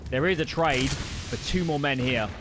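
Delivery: loud, excited match commentary; live esports play-by-play. Real, not acted. Caster tells viewers of a flashbang trade, excited play-by-play. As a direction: call the action loud and urgent.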